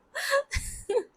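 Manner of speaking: squealing laugh fading down; high laugh-cry. Real, not acted. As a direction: laughs helplessly at herself. Laughing after the swap thought.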